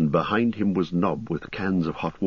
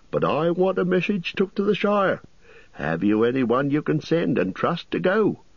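higher, bustling landlord voice; fussy innkeeper; 8 semitones higher than usual. Acted. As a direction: play the chatty innkeeper, pitched up.

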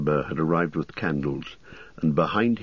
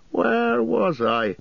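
higher, bustling landlord voice; fussy innkeeper; much slower than usual. Acted. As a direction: play the chatty innkeeper, pitched up.

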